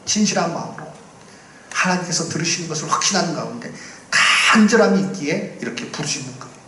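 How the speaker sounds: ordinary delivery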